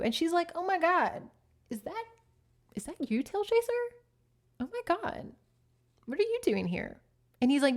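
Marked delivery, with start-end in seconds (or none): 0.5-7.0 put-on higher, gushing surprised girl voice; a pampered cat surprised by her old friend; surprised she-cat. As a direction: act thrilled to see an old friend. Hushpad surprised to see him.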